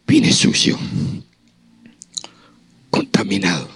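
hushed whisper; confessing sin in a whisper. Retold or imitated. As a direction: breathe the words out.

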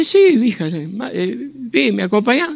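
voiced high, playful; mock high voice. Voicing himself asking mother.

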